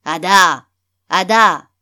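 loud bawl up the stairs; bellowing upstairs. A narrator's reading. Man yelling upstairs for his wife, bellowing. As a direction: holler the name at full volume. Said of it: "Il brailla en direction de l'étage".

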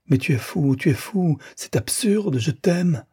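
high-pitched, rising, imploring voice; desperate pleading. A narrator's reading. Rosanette holding Frédéric back, desperately pleading. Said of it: "Elle le suppliait".

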